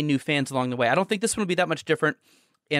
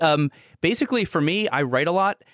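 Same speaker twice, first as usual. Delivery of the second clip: usual speaking voice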